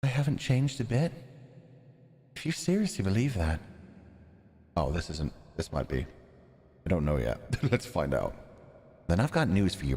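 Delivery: put-on low, soft, menacing scheming girl; cold villainess. Maria coldly dropping her act before the friend, low menace. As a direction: purr the threat low and cold.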